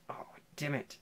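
whispered, resigned "oh, damn it"; nervous boy whispering to himself before the kiss. Retold or imitated. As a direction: breathe it out under your breath, resigned. About to be kissed.

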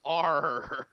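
loud high hammy pirate. Pretend. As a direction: bellow it like a cartoon pirate. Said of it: "Pirate voice".

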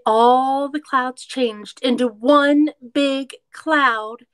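lively storybook read-aloud; reading to children. A narrator's reading. Teacher reading a picture book to kids, lively read-aloud.

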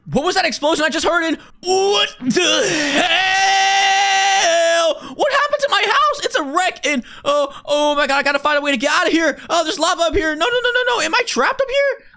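high-pitched alarmed yelling; hammed-up disaster panic; panicked shouting. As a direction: scream awake to find the house blown up. Woken by explosion, house wrecked.

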